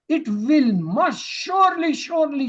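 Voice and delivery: high, forceful push on each "surely"; insistent emphasis. Shown as a demonstration. Teacher translating a doubly stressed verb, insistent on "surely". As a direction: punch SURELY high, twice over.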